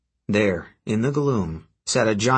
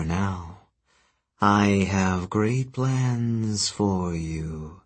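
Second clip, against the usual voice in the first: sinister goddess; 2 semitones lower, much slower than usual. Acted. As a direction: lull him with slow menace. Gaia continues speaking to Percy.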